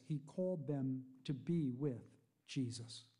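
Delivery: normal talking voice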